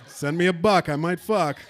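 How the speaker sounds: ordinary delivery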